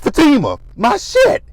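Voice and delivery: high, loud wail as his things break; hammed-up pained cry, high and loud; mock anguished wail. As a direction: shriek over his smashed table. Man wails as table smashed.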